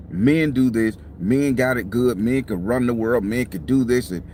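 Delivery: ordinary delivery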